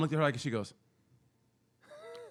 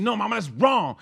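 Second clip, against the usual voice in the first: put-on kid standing up; 8 semitones higher, 5 dB louder than usual. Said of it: "stood up to her and said".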